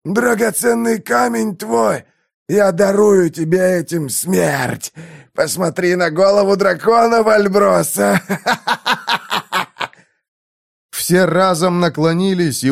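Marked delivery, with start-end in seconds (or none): 0.0-8.2 high, slow, gloating cracked voice; gloating dying man. A narrator's reading. Dying prisoner gloats at Valbrosa. 8.3-9.9 high mad dying laugh; crazed laugh. A narrator's reading.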